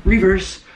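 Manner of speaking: high, loud mock reverse effect; reverse sound. Shown as a demonstration. Showing the camera a silly reverse noise. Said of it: "let me do one of them real quick".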